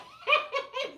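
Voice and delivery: quick high laugh after giving up; a helpless little laugh; short laugh. Real, not acted. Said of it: "Y'all got me cracking up here".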